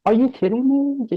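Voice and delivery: high-pitched retold disbelief; stunned disbelief. Telling the crying boss, in high-pitched disbelief. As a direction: pitch it way up as if you can't believe it.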